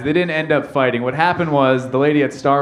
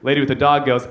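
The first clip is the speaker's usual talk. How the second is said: ordinary talk, nothing marked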